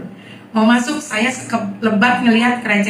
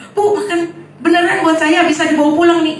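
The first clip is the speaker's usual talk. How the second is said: put-on delighted kid; 8 semitones higher than usual. Kids delighted by free crackers.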